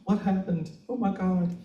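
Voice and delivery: high, alarmed partner voice; Frankie's worried question. Retold or imitated. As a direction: play the startled partner asking what's wrong. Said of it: "And he goes".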